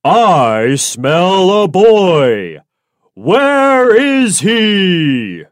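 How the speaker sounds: slow, loud, menacing giant voice; sniffing giant. Acted. Giant sniffing out Jack in his house, slow and menacing. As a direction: drag out each word like a hungry giant.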